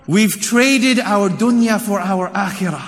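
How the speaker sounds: ordinary talk, nothing marked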